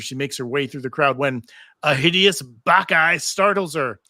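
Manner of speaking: ordinary delivery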